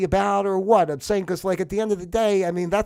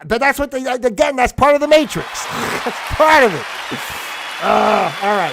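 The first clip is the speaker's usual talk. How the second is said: louder and higher, laughing at his own rant; worked-up laughing; 6 semitones higher, 8 dB louder than usual. Real, not acted. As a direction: peak the rant then laugh it off.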